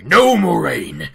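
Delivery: drawn-out, hammed-up angry objection; acted anger. Lan suddenly objects to Moiraine, acted anger. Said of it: "suddenly he gets all angry".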